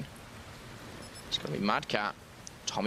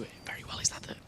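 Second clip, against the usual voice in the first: hushed, softer half-finished question; whispering; 8 dB quieter, much faster than usual. Real, not acted. Co-caster spots something in the game, whispering. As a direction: drop into an accidental whisper. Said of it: "why am I whispering?".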